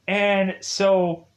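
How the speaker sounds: ordinary delivery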